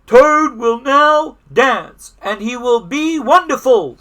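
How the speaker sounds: high, loud, slow announcement; stage announcer. A narrator's reading. The voice introduces Toad's high-wire act, slow loud announcer. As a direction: draw out each phrase for the crowd. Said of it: "said the voice".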